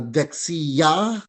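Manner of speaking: "dexia" drawn out, lifting on the last syllable; teacher's demo of "dexia" with upward accent; rising accent. Shown as a demonstration. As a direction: slowly raise the pitch at the end of "dexia". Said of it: "medyo pataas siya".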